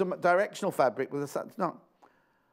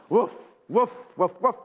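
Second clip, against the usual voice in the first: louder mock dog barks; playful barking; 2 semitones higher, 5 dB louder, slower than usual. Host joking over dog fabric, mock barking.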